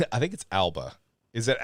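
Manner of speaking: ordinary delivery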